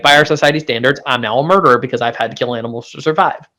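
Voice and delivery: normal talking voice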